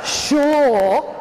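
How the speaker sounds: exaggerated yeah-right scepticism; sceptical. Voicing the audience's sceptical reaction.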